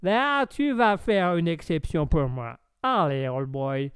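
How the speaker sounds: put-on slow American-accented French. American coaxing the host into a drink, American accent. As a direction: coax in a drawling American accent. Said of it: "les accents commencent un peu à se perdre".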